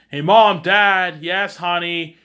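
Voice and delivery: high, loud shout to the parents; calling out. Retold or imitated. Friend calling parents about a guest, loud high call. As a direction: call across the house to the folks.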